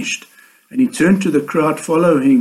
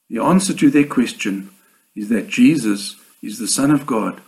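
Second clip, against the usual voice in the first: normal talking voice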